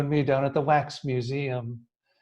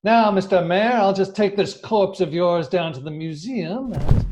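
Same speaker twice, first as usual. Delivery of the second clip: normal talking voice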